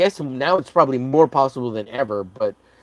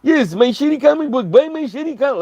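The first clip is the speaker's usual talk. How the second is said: high whiny mock child voice; high hammed-up childish whine; mocking childish begging; 12 semitones higher, 4 dB louder than usual. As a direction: whine like a spoiled kid. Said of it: "It's like".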